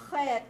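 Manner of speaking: Chet with a scraped throat "kh"; guttural Chet. Shown as a demonstration. Teacher showing a guttural letter, Chet with a throaty "kh". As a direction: rasp the first sound of Chet in the throat. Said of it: "notoriously a guttural".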